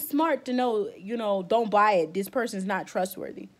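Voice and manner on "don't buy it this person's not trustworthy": ordinary talk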